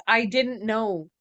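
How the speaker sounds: usual speaking voice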